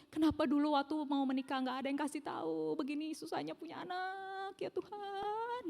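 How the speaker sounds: a weary sigh to God, fading away; mother crying out inwardly to God, softening and slowing; despairing inner lament. Retold or imitated. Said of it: "Dalam hati saya begini".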